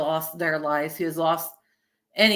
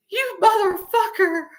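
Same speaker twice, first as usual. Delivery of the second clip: high-pitched, hammed-up indignation; mock outrage; 12 semitones higher than usual. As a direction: act scandalized by a returning lover, pitched way up. Acts out outrage at returning lover.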